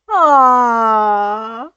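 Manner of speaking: loud delighted aww. Real, not acted. Reader cooing over the friendship, loud. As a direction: coo at the sweet scene.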